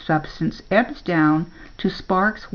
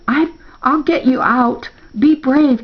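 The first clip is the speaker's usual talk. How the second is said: raised, straining plea to hang on; urgent encouragement; 6 semitones higher, 4 dB louder than usual. A narrator's reading. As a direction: urge a friend on in an emergency. Straining to hold the dog.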